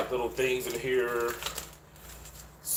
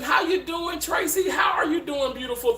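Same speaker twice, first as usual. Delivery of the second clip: excited greeting; 13 semitones higher than usual. Real, not acted. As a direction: holler a happy welcome.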